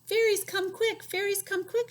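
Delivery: high, loud call; calling shout. Acted. Old woman calling the fairies out of the house. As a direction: call out to lure them. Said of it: "she shouted".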